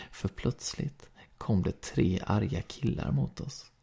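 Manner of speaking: plain reading voice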